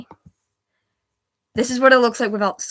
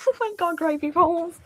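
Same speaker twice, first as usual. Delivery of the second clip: laughing; 8 semitones higher than usual. Real, not acted. Still laughing at typo.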